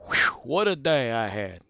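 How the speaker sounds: lightly voiced, slow weary sigh of relief; tired mustache. Tom Selleck's mustache sighing after a shoot, lightly voiced. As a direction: play a mustache worn out after work. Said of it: "his mustache comes off and goes".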